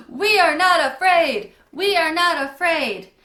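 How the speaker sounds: loud, high, full-voiced shout; confident shouting. A narrator's reading. Campers chant at the campfire, confident shouting. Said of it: "the volume increased".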